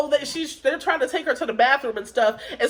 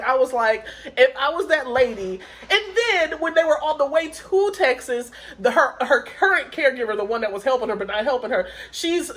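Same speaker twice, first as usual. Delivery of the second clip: usual speaking voice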